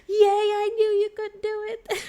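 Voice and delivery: very high, loud, delighted squeal; squealing cheer. Retold or imitated. Girl cheering the hero flying again, high squeal. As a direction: cheer him on like a proud fan.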